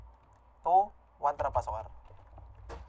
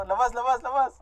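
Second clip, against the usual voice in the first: raised, higher-pitched urgent call; urgent shout; 6 semitones higher, 5 dB louder than usual. Real, not acted. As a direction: call them out urgently, repeating the word. Urgently calling teammates out. (pasigaw na pagmamadali)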